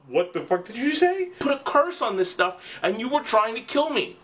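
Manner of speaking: high-pitched mock indignation; acted outrage. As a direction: imitate a shocked, outraged accusation. Shocked accusation in the story.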